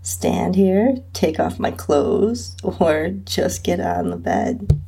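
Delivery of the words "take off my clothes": ordinary delivery, nothing marked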